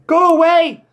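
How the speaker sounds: high, loud mock scream. Cho reacting to losing the lead, screaming. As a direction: scream it in protest at the comeback. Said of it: "Chose was screaming".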